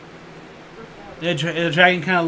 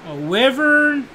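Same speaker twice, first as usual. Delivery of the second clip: mock player guess; 7 semitones higher than usual. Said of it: "you're like".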